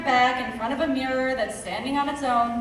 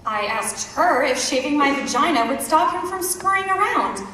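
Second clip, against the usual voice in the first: much louder, projected outburst; loud indignation; 2 semitones higher, 8 dB louder than usual. Acted. Woman telling of asking about shaving, loudly indignant. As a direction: belt it out to the hall. Acted protest in a monologue.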